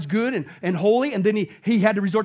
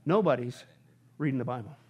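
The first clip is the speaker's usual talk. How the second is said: ordinary talk, nothing marked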